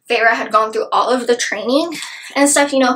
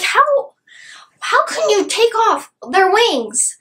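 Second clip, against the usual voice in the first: high-pitched, distressed wail; anguished disbelief; 9 semitones higher, slower than usual. Real, not acted. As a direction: plead it high, almost crying. Dismayed reaction to the book.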